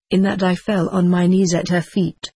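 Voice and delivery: ordinary delivery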